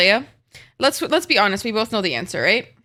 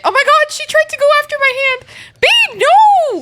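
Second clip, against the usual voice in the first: much higher, louder panicked shriek; 8 dB louder than usual. Real, not acted. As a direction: shriek as the cat grabs. Cat attacks her hand.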